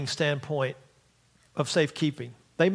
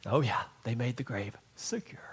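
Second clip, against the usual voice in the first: whisper; 6 semitones lower, 7 dB quieter than usual. Real, not acted. Mocking whisper of the verse.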